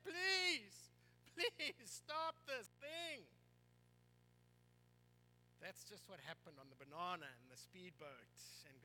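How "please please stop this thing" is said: pleading. Retold or imitated. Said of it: "I'm saying".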